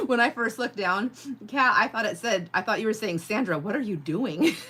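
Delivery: giggling while reading the chat; real laughter bubbling through the words; laughing. Real, not acted. Laughing at her own misreading of the chat.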